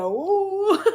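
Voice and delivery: high loud wail breaking into laughter; exaggerated bawling, laughing through it; mock ugly cry. Streamer showing her own ugly cry, hammed-up wail. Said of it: "I have that ugly cry".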